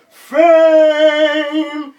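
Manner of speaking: long, high, very loud yell. Retold or imitated. Rapper trapped by the mic stand, long loud yell. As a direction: hold the shout out over the crowd. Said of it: "I kept on yelling".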